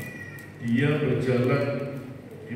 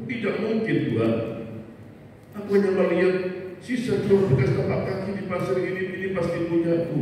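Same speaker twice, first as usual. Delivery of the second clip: much higher, pleading cry; desperate plea; 8 semitones higher than usual. Acted. Man on the beach calling to God, high desperate plea. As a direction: lift the voice high as if about to break.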